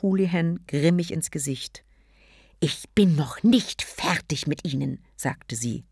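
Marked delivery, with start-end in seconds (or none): 2.6-5.0 hissed whispered threat; threatening whisper. Acted. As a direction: whisper it menacingly. Threat at the door.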